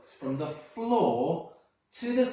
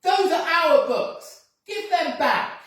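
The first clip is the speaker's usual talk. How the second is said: high, loud, slow yell; children yelling at the thief; 11 dB louder, slower than usual. Acted. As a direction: demand it back at the top of your voice. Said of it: "the children yelled".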